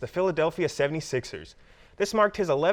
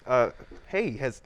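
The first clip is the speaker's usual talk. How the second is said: confused forgetful voice; 5 semitones higher than usual. Acted. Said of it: "We'll get through this together".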